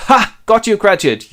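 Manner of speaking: high, loud triumphant burst; gleeful gotcha. Acted. Scrooge springs his prank on Cratchit, gleeful. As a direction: crow it loudly, pleased with the prank.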